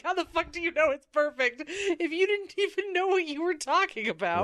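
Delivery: high-pitched baffled rant; incredulous. Retold or imitated. Host challenges the boss's claim it's perfect, incredulous. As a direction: demand an answer in exasperated disbelief. Mocking disbelief at the comic's plot.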